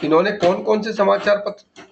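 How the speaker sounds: ordinary delivery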